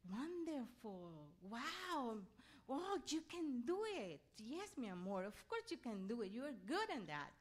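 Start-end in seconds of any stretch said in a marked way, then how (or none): none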